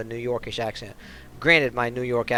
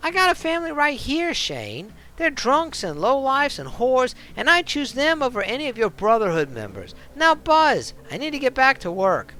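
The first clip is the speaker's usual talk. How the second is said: put-on tough bartender snapping back in a New York accent; defiant New York accent; 13 semitones higher, 5 dB louder than usual. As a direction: put him in his place, New York style. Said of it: "a bit of a New York-ish kind of accent".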